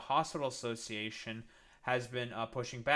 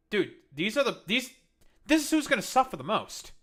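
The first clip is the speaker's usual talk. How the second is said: loud, high-pitched exasperated scolding; angry lecture; 7 semitones higher, 6 dB louder than usual. Real, not acted. Streamer scolding Trump supporters who will suffer most, loud and high. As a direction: hammer it home, frustrated and raised.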